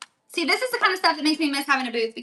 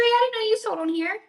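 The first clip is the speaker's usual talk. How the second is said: ordinary talk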